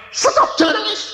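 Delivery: very high-pitched commanding shout; retold yell. Eldest child ordering siblings to stop crying, retold yell. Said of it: "Saya teriak".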